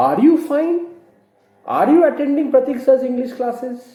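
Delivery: high questioning tune through "fine" and "classes"; teacher's demo question with "fine" and "classes" lifted; rising intonation. Shown as a demonstration. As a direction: model the question, pitch climbing and held high. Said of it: "a rising intonation".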